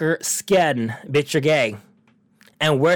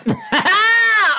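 high laugh; 3 dB louder than usual. Real, not acted. Cracking up at own food joke to listeners, high laugh.

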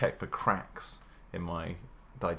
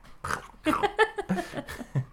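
high burst of laughter. Real, not acted. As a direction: shriek of laughter, pitch way up. Big laugh after silly bit.

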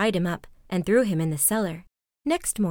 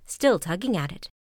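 ordinary delivery, nothing marked

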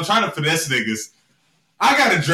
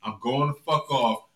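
mock low threat; 5 semitones lower, 5 dB quieter than usual. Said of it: "I told exact words".

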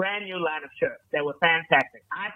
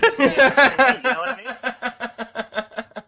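slow, laughing through words; giggling; 6 semitones higher, 5 dB louder, much slower than usual. Real, not acted. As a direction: laugh while you say it.

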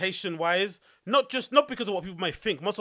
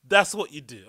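ordinary talk

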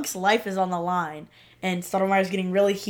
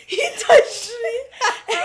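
high, drawn-out wail through laughter; hammed-up high-pitched whine; mock distraught quote; 13 semitones higher, 5 dB louder, much slower than usual. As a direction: wail it slowly and loudly, half laughing. Re-enacts character's dramatic complaint.

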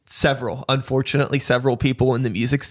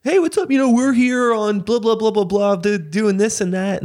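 high, eager sales pitch parody; mock self-promoter; 6 semitones higher than usual. Mocking a rapper pitching himself, high and eager. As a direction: imitate a pushy networking rapper.

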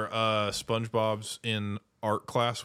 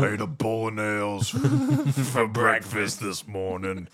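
put-on slow tough talk; cartoon tough guy. Quoting the tough guy bragging, slow cartoon voice. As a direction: boast slowly in a put-on voice.

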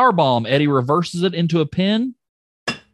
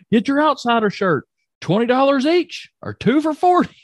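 Scott Hall impression; 12 semitones higher than usual. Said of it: "Scott Hall".

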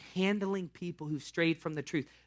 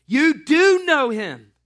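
high, loud and slow accusation; shouting the accusation in his face; insistent shout; 9 semitones higher, 13 dB louder, much slower than usual. Acted. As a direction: nail him with it, slowly.

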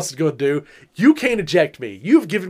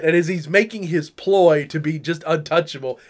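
normal talking voice

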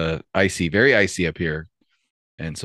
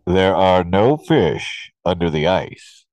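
slow, booming hidden voice; 3 dB louder, slower than usual. Retold or imitated. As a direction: boom it out slowly. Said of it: "booming voice".

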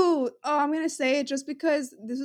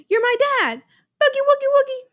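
high, lively mock performance; silly excited imitation; 10 semitones higher, 5 dB louder, faster than usual. Acting out the video's presentation, high silly delivery. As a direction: chant it high and playful.

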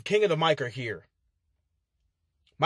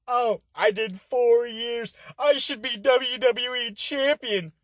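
mocking the opponent; 6 semitones higher, slower than usual.

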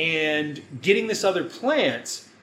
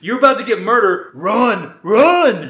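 shouted louder and higher at the screen; yelling a warning; 5 semitones higher, 10 dB louder than usual. Retold or imitated. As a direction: yell at her to run, getting louder. Yelling warning at movie character.

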